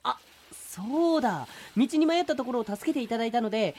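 an overly polite turtle asking a stranger the way; cheerful and pushy, a salesman turtle; eager polite turtle. Acted. Turtle addresses Saito.

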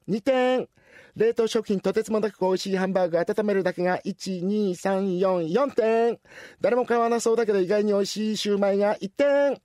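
bright clerk calling out each item; cashier. Acted. Cashier reading the grandma's purchases aloud, bright. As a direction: announce every item clearly.

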